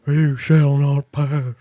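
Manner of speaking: loud, drawn-out bad character impression; hammy, slow impression of a TV character; Joel. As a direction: proclaim it slowly in a terrible put-on voice. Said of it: "terrible Joel impression".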